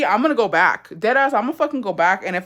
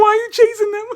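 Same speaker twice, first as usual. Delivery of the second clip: high, laughing imitation of her friend; mock friend laughing in disbelief; laughing quote; 5 dB louder than usual. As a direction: squeak it out through laughter. Friend's reaction voiced laughing.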